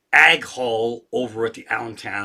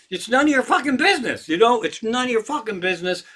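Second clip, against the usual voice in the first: exasperated rant; 7 semitones higher, faster than usual. Real, not acted. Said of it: "ranting at nosy trolls".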